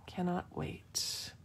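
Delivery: breathy whisper of cannot wait; whispered echo. Real, not acted. To her viewers, echoing herself in a whisper. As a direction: repeat it under your breath, slow. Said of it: "echoing her own words".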